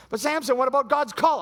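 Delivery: high, loud urgent appeal; mock pleading shout. Friend pleading with Samson, high loud appeal. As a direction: plead with him loudly.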